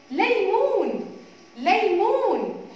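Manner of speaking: ليمون stretched slowly and loudly, leaning on the L; drawn-out word. Shown as a demonstration. Teacher to children, showing the L sound: ليمون drawn out slowly and loudly. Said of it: "لقينا أصحاب أهو لحرف اللام".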